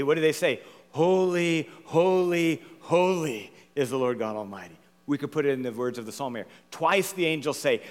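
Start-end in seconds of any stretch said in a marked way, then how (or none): 0.9-4.8 solemn angelic proclamation. Acted. Quotes the angels' threefold cry.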